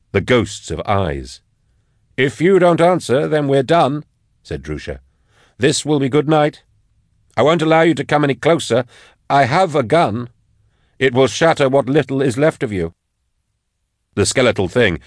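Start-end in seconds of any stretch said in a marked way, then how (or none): 2.2-4.0 raised, higher character voice; challenging warning. A narrator's reading. Armed warning to advancing skeleton. 5.6-12.9 high-pitched, forceful warning; armed man's stern warning. A narrator's reading. Voices Drusha's armed warning.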